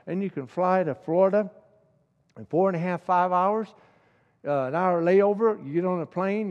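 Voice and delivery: fervent pulpit preaching, climbing in volume; preaching at full force. Acted. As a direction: preach it, building to a near shout. Preacher builds to a loud peak.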